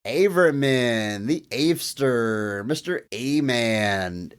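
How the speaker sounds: slow, drawn-out nickname riffing; Rob Schneider's copy guy. Acted. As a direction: stretch each nickname out like a teasing office pest. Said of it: "took Rob Schneider's Macon Copies guy".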